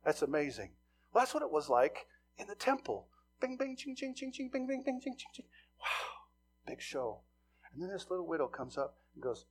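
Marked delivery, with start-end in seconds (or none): 3.4-5.5 high, light mock coin chimes; coin clinking sounds. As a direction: mimic coins dropping in. Imitating coins in temple.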